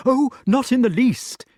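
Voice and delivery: high, loud, rushed cut-in; a flustered host cutting off an awkward question; hasty interruption. A narrator's reading. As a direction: jump in before he can finish. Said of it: "interrupted Sir Ector hastily".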